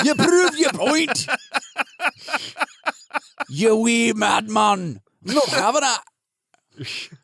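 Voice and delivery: put-on Irish accent. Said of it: "let's retcon that a little bit".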